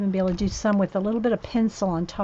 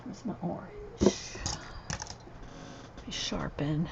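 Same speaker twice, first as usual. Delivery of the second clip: whispering; 3 semitones lower, 11 dB quieter than usual. Real, not acted. Muttering while working.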